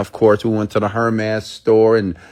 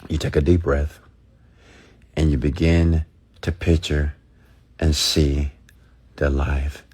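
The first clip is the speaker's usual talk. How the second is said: guided visualization; 5 semitones lower than usual. Acted. Speaker guides listeners to close their eyes, low and calm. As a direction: talk them into picturing their life, calm and low.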